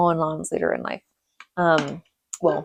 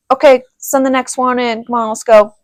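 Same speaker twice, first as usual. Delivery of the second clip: a bit louder, barking orders like dad; stern dad voice; 6 semitones higher, 6 dB louder than usual. Retold or imitated. Retelling dad calling the next kid in, stern voice. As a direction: call the next kid in like a strict father. Voicing dad during spankings.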